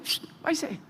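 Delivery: a hushed, breathy whisper; whispered dismissal; whispered aside. Retold or imitated. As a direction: whisper it like a guard letting him slip away. Officer voiced dismissing him.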